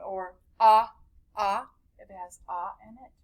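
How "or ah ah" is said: ah said as an open short o vowel; teacher modelling the short o sound ah. Shown as a demonstration. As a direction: say ah like the o in shop. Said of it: "short O sound".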